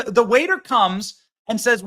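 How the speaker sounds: usual speaking voice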